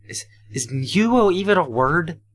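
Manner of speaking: can't believe the word; incredulous disbelief; baffled. Acted.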